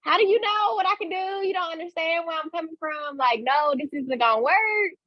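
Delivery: a retold skeptical comeback, pitched high; incredulous retort. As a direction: push back in high-voiced disbelief. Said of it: "I'm looking at them like".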